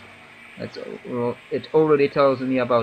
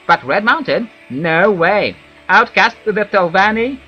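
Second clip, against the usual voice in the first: higher, louder elf villager voice; Wood Elf voice; 6 semitones higher, 5 dB louder than usual. Acted. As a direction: give it a gruff elf warning. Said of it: "my Wood Elf voice sounds like that".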